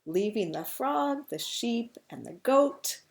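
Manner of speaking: animated reading, loud on the last words; picture-book storytelling. A narrator's reading. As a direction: hold back, then land the punchline loudly. Reads the book's punchline.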